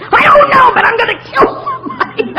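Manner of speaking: high, loud half-laughing yell; laughing shout of fury; mock furious shout. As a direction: rage comically at the sponsor. First anger, calling sponsor.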